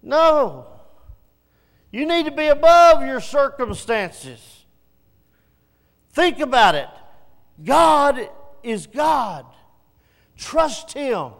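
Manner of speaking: loud, high rebuke; slow shout of God is God; preaching shout. Real, not acted. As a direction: thunder back at the excuse.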